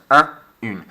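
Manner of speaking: un nasal then une with a French u; un versus une. Shown as a demonstration. Teacher contrasting masculine and feminine articles. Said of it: "look at the difference".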